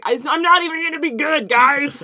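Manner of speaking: high, loud little crab whine. Acted. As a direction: squeak it out like a defeated crab. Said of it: "Excellent crab voice".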